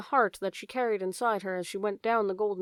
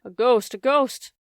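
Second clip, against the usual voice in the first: higher, louder, slower alarm; frightened cry; 4 semitones higher, 7 dB louder, slower than usual. Acted. Head mouse frightened by Despereaux.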